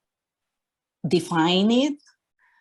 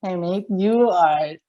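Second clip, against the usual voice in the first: quoting her through a laugh, slowly; a retold line broken up by laughter; slow amused laugh while praising; 3 dB louder, slower than usual. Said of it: "she laughed".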